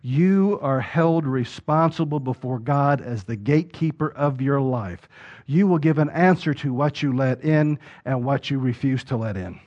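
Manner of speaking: ordinary talk, nothing marked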